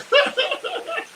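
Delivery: a high burst of laughter. Real, not acted. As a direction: laugh out, delighted. Laughing with Jill.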